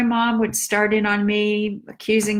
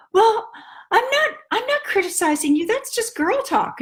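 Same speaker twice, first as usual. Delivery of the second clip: much higher, defensive mother; mother's voice; 12 semitones higher than usual. Retold or imitated. Daughter retelling how her mom denied criticizing, high defensive mother. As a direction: play mom brushing it off, pitched way up.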